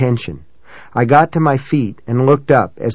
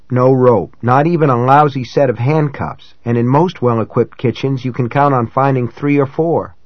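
plain reading voice

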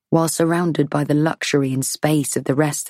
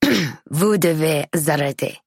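put-on slow, curt old woman voice in French; barked French; slower than usual. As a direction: bark it like a stern old lady. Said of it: "she barks".

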